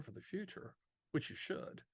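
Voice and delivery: whispered under the breath; hushed aside. Real, not acted. Agent whispers a sly aside to viewers about planning ahead. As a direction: let it slip out as a quiet whisper.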